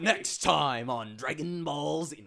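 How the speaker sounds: big, loud, drawn-out announcer; hammed-up cartoon trailer voice, slow and loud; anime preview announcer. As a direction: hype the next episode at full volume.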